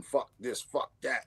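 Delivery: ordinary delivery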